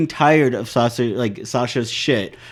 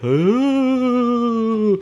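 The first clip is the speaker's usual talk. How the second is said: high, drawn-out ghost "Oh"; ghost moan; 9 semitones higher than usual. Shown as a demonstration. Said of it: "we make 'em go like".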